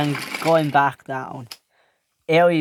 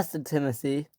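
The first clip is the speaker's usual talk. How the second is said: plain reading voice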